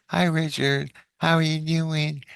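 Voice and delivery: put-on high, chirpy sing-song girl voice; girl in his head. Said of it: "She's talking".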